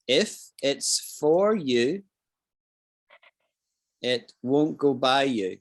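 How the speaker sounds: slowly, each word spaced out; slow, careful repeat. Shown as a demonstration. Teacher repeating the Scots saying slowly for the class. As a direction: spell the saying out slowly for learners. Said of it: "and it's this".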